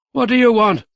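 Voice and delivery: high, loud demand; sharp demand. A narrator's reading. Mayor startled by intruders.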